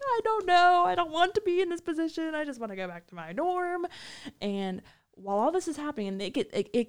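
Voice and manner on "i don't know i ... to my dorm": high, pleading whine; exasperated. Retold or imitated. Narrator caught in the quarrel, high and pleading. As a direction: beg to be let out of it. Caught between arguing inmates.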